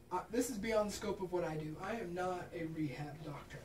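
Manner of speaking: ordinary talk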